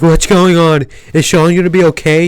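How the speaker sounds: girl asking if Sean will be okay, in a mock panic; imitating panicked girl. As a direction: play her frightened and out of breath. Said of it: "She's, like, hyperventilating".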